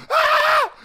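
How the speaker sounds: very high shouted yelp; mock cry of pain when knocked down. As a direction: yelp as you get flattened. Getting pancaked.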